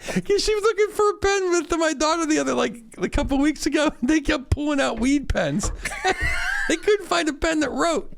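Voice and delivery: high, laughing through the story; can barely get it out for laughing. Real, not acted. Laughing while telling wife story.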